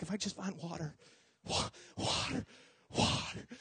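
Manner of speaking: parched gasp. Retold or imitated. Lost kid gasping for water, faint breathless rasp. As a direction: croak like dying of thirst. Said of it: "You think, like".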